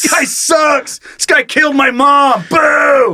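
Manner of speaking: boo him from the bleachers; heckling shout. Pretend. Mock heckler in stands.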